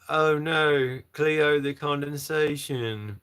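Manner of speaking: drawn-out, exaggerated Australian accent. Shown as a demonstration. Asked by a viewer for an Australian accent, reluctant Australian attempt. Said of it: "asking me to do an Australian accent".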